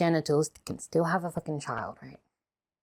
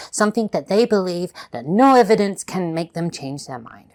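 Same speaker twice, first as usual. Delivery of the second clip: ordinary talk, nothing marked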